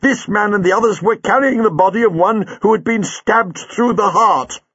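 high, mild angry telling; lightly voiced warrior's anger. A narrator's reading. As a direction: Leela insists to the sergeant, mildly angry. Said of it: "said Leela angrily".

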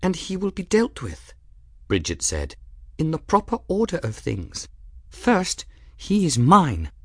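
put-on high, controlled young woman's voice; Bridget, firm and composed. A narrator's reading. Young woman to her angry cat, firm. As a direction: claim him firmly, pitched high.

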